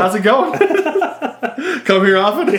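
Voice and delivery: high, loud playful pickup line; mock chummy, laughing. Joking along as the chatty man in the stall, laughing mock greeting. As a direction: laugh through a pretend friendly greeting.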